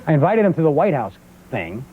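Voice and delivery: usual speaking voice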